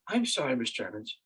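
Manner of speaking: low, soft imitation of a regretful nurse; retold soft apology. Nurse apologising to her patient, hushed and low. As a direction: drop right down in pitch and volume.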